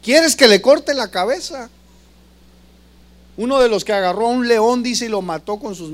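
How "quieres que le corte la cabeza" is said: higher, louder eager offer to cut off a head; mock warrior volunteering loudly. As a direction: push it like a fierce bodyguard itching to act. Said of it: "uno de los valientes de David le dijo".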